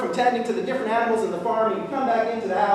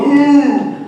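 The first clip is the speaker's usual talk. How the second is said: loud storyteller's moo; cow moo; 3 semitones higher, 8 dB louder than usual. Retold or imitated. Cow watching TV in the living room, mooing loudly.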